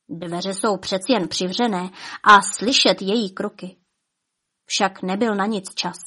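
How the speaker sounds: usual speaking voice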